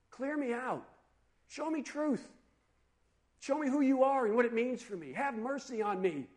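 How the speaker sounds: acted pleading, not shouted; earnest prayer. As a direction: pray it as a heartfelt request. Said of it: "You pray for what you need most".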